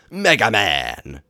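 slow, loud villain roar. Acted. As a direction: bellow his name like a villain. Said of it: "the evil genius roared".

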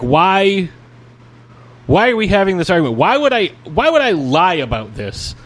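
venting disbelief at the argument; exasperated. Real, not acted. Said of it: "getting mad".

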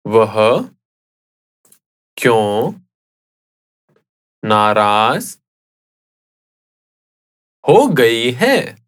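slow, word by word with long pauses; slowly. Shown as a demonstration. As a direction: leave a gap after each chunk for repeating. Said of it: "I'll say it again slowly".

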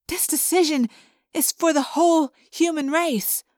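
urgent, imploring argument; pleading. A narrator's reading. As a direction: plead with a friend to understand. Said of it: "Cassie pleaded".

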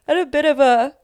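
words stretched by a yawn. Real, not acted. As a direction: talk through a big yawn. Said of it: "I'm yawning".

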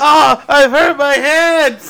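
high, loud, drawn-out wail; mock pained cry. Friend acting out a hurt film hero for laughs, mock pained cry.

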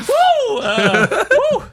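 high loud woo through laughter; excited whoop. Real, not acted. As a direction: whoop it out with delight. Cheering the joke amid laughter.

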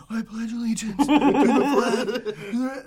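slow, high, stiff pledge recital with a laugh; mock reading voice. Shown as a demonstration. Host imitates reading the pledge over the intercom, stiff. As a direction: recite it like a nervous kid on the intercom. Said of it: "I was like".

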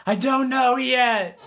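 high, stretched mock scream; imitating a high-pitched flustered holler; retold scream. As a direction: yell back in a panic, held down in volume. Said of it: "And I just screamed".

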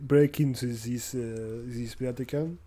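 put-on French accent. Swiss guard at the door.

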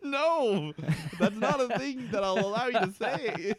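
high, loud, laughing refusal; laughing protest. Real, not acted. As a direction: object through real laughter. Cracking up at co-host's phrase.

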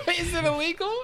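high, squeaky laughing question. Real, not acted. Friend answering that it's illegal, high and laughing. Laughing reply to legality remark.

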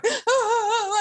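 put-on high, teary little-kid wail; mock crying child; imitated child's wail. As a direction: wail like a sobbing six-year-old.

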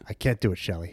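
quiet, flat refusal. Retold or imitated. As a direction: deliver it low and cold. Quoting Williamson's line.

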